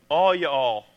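a light Southern "all you all", drawn out; Southern drawl. Retold or imitated. Pastor imitating Southern folk, light Southern accent. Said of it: "Southern people who use the phrase".

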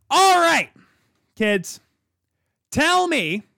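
loud, high ranting yell. Real, not acted. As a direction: shout it at the audience, all worked up. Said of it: "as I'm yelling and screaming here".

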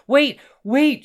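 high, loud shouts; loud, desperate cry; panicked shout. A narrator's reading. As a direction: shout out to stop someone, high and urgent. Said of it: "shouted the man".

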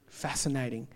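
ordinary delivery

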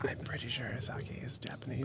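hushed reply; whispering. Real, not acted. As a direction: whisper back the answer. Whispering back the answer.

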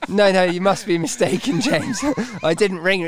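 high-pitched protest with laughter in it; acted lying denial. Trainer fibbing to an angry colleague, high laughing denial. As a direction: act out a hopeless fib, amused at yourself. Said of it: "I just lied through my teeth".

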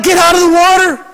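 high, fast shouted warning; urgent shout. Retold or imitated. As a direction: yell at them to get out. Warning girls about a shark.

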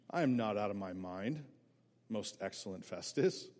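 Paul answering Festus, low and quiet; calm and cool. Acted. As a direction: stay calm and respectful under attack. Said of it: "Keeps his cool".

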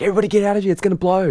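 high, loud alarm; panicked shout. Retold or imitated. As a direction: yell an evacuation warning. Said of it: "It's like".

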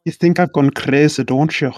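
a mild Scottish lilt, falling away; Scottish accent. Acted. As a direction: quote it with a hint of Scottish. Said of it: "I can't do a Scottish accent".

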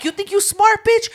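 high, loud challenge; mock taunt. Talking to the dog that slipped its collar, high loud taunt. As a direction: taunt with pitch leaping way up.

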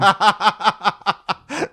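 loud, high laugh; high burst of laughter. Real, not acted. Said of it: "Now I'm terrified".

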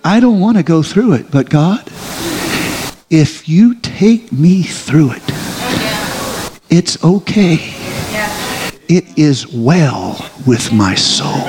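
slow, yielding prayer with long pauses. Acted. Preacher voicing a surrendered believer's prayer, slow and yielding. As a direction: surrender it to God, slowly. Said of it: "you slay it on that altar".

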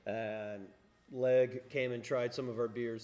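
ordinary talk

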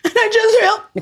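high, fake tearful voice; mock breakdown. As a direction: pretend to break down crying. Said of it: "if I just had a breakdown".

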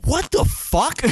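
high, startled disbelief with a laugh; shocked what the fuck. Retold or imitated. Retelling finding a fish in his dead tank, high and amazed.

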